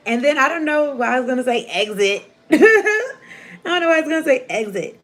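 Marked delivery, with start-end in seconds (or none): 2.5-2.6 laughing. Real, not acted. Laughing at own misreading. 2.6-3.6 sudden high squealing laugh; burst of high laughter. Real, not acted. Reader laughing after misreading exist as exit, high squealing laugh. 3.6-5.0 laughing. Real, not acted. Laughing at own misreading.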